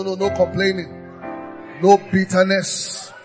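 usual speaking voice

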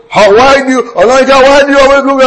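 mock senior's challenge. Senior challenging him in school.

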